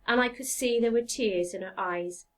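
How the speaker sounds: ordinary delivery, nothing marked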